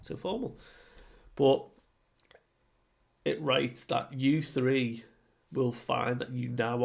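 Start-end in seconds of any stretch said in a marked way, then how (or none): 0.0-0.6 high, mocking so formal. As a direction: tease it in a lifted voice. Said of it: "It's like".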